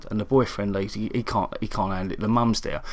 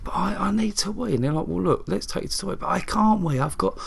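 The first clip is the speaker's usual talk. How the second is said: high, whining mock patient; high imitation of a moaning patient; whiny patient; 9 semitones higher than usual. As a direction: whine it like the patient on the ward. Voicing the patient's whiny complaints.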